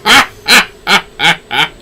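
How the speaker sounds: haughty laugh. Acted.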